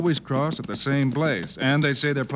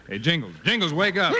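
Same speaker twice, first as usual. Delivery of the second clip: raised call to wake him; 6 semitones higher than usual. Acted. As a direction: rouse a dozing partner. Waking sleeping partner.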